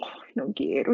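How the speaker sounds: whispered, grudging complaint; a bothered child muttering under the breath; annoyed child. Shown as a demonstration. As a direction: sigh it out, reluctant and low.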